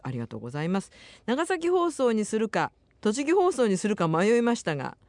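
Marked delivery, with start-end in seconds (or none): 1.3-5.0 higher-pitched, lively radio letter reading; reading listener mail. Acted. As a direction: read the listener's message out brightly, pitched up. Reads listener's mail on air. (お便りを読み上げる高めの声)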